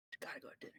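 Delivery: urgent breathy whisper. Acted. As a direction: whisper it close to her ear.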